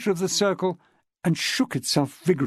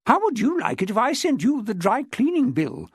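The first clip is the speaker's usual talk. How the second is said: riposte; 7 semitones higher than usual. Acted. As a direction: fire it back at him. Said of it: "Miss Chilmark riposted".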